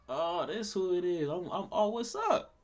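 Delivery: high, loud greeting acted out; sudden recognition. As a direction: play up finding out who has been texting. Acted reaction on learning texter's identity.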